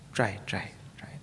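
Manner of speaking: coaxing whisper. Retold or imitated. Tempting student voiced.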